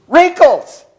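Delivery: put-on high, loud kid's punchline; cheeky little boy. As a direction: deliver it like an innocent kid. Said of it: "the little boy looks at Grandma and goes".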